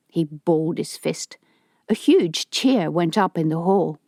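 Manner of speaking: ordinary delivery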